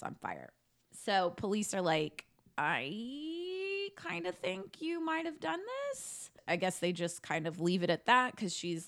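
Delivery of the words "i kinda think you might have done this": high, slow, sing-song accusing voice; mock suspicious cop. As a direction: drawl out the accusation like a smug cop. Police accusing her.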